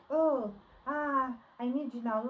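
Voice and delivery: exaggerated, pushy neediness; mock needy demand. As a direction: groan it out like a pestering suitor. Mimics man coming back with demands.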